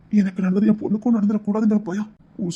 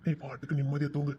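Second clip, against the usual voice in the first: much softer, gentle lull with weight on நீ; quiet reassuring goodnight; tender soothing; 2 semitones lower, 10 dB quieter than usual. Acted. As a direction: settle her down to sleep. Soothing her to sleep.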